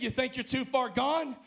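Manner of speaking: ordinary delivery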